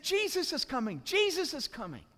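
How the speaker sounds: high-pitched excited announcement; acted onlooker's eager cry; excited crowd. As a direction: spread the news in a high voice.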